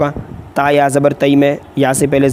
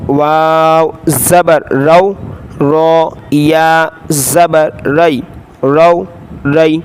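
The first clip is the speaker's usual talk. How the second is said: Qaida spelling drill; much slower than usual. Shown as a demonstration. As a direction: call out each letter for the class to repeat.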